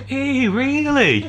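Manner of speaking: high, slow, drawn-out mock wonder; mock amazed nurses. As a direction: stretch it out like gushing nurses. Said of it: "they're all going".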